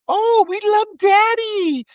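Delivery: high, slow, sneering mock-adoring voice; mock the worshippers in a silly high voice; sing-song sarcastic fan; mocking fanboy voice.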